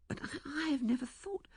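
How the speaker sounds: high, soft, whispery voice; hushed hesitation; wavering young woman. A narrator's reading. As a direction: falter in a small airy voice.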